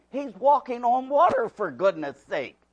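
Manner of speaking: usual speaking voice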